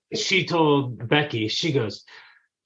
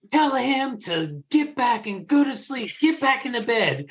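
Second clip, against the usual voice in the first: imitated yelling; 10 semitones higher than usual. Mom imitates daughter yelling at sister, mock yell. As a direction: yell it lightly, voice pushed way up. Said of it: "hear her just yelling at Becky".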